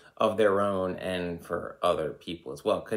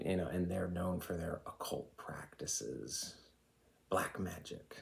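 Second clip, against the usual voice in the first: hushed conspiratorial whisper, much softer; 7 dB quieter than usual. Real, not acted. Vlogger confides occult rumour to viewers in a whisper. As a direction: lean in and whisper the secret.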